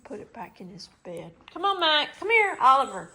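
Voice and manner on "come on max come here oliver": high, loud call to the dogs. Real, not acted. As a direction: call them over. Calling the dogs.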